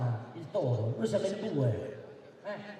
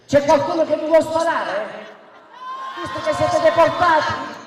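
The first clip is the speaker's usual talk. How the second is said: high, shouted rally oration; booming balcony speech; 10 semitones higher, 14 dB louder than usual. Real, not acted. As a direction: thunder it from a balcony. Heated rally speech, shouting at opponent.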